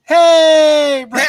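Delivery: loud hey to get attention; calling out. Real, not acted. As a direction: call across the room. Calling muted Brandon.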